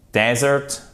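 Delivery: desert said DEH-zert, first syllable stressed; clear, stressed DE in desert; first E as open E. Shown as a demonstration. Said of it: "il se prononce comme un E".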